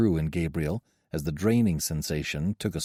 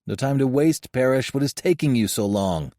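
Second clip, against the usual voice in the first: sharp impatient scolding, louder; 5 semitones higher, 5 dB louder than usual. A narrator's reading. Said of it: "Alexander scolded".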